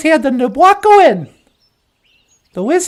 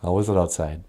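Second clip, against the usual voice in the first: much lower, odd voiced character; low puppet voice; 12 dB quieter, faster than usual. Puppet answering Natasha, deep voiced character.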